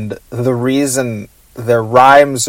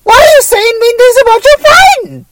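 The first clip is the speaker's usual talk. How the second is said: squeaky high voice; 5 dB louder than usual. Pretend. Squeaky character voice teasing.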